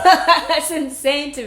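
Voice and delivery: slow, laughing disbelief; amused, high laughing remark. Real, not acted. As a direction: laugh through the words. Laughing at the story.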